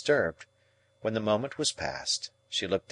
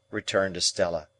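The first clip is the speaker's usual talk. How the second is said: ordinary delivery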